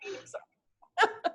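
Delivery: apologizing through a laugh; breaking into laughter mid-word. Real, not acted. Laughing through emotional moment.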